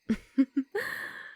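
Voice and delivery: high, amused little laugh; enjoy his struggling; delighted laugh. Acted. Vampire enjoys captive's struggling.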